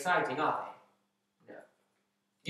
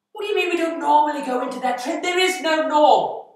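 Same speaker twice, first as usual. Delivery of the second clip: high, loud outburst of exasperation; furious shout; 11 semitones higher, 10 dB louder than usual. Acted. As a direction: berate an incompetent underling at full volume. Boss berating an underling.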